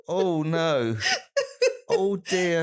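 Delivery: high, laugh-filled groans of disgust; laughing dismay. Real, not acted. Listener hears a gross punchline, laughing dismay. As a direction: react appalled while helplessly amused.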